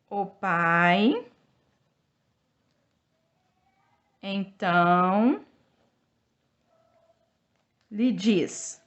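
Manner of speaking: word by word, long pauses between; syllables drawn out for children to copy; slow dictation. Shown as a demonstration. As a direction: dictate slowly while writing on the board. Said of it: "teacher dictating while writing on board".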